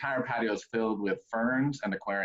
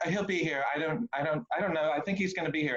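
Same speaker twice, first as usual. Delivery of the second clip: high, fluttery bride voice; lightly voiced flustered bride; 6 semitones higher than usual. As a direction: play the nervous bride, pitched up. Bride voiced in retold story.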